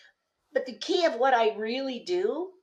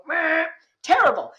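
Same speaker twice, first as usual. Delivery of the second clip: loud, higher mock dismissal of the answer; blunt verdict; 8 semitones higher, 6 dB louder than usual. Coach dismissing an attendee's pitch, loud and mock-scornful. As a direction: toss it off as a teasing put-down. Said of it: "And I go".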